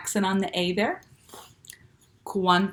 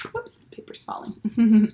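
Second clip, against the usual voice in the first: whispered oops; 3 semitones higher, slower than usual. Real, not acted. Paper slips mid-lesson.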